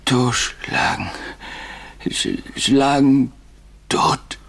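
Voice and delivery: slow, broken recollection of a threat; halting echo. Retold or imitated. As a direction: drag the words out with long pauses. Narrator haltingly echoes the remembered threat.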